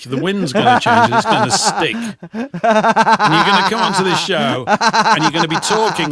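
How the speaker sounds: giggling tease; laughing telling off of a friend; laughing while talking. Real, not acted. Teasing friend, laughing while scolding.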